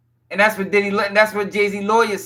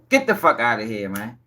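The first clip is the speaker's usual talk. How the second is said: low, quieter repeat of the brush-off; scoffing dismissal; 11 semitones lower, 4 dB quieter than usual. Real, not acted. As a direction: shake your head at it.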